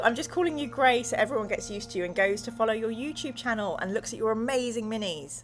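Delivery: ordinary talk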